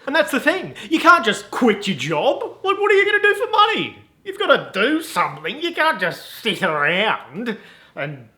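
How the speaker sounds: high, mock-scolding voice; nagging objector. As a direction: fret shrilly about money, hammed-up.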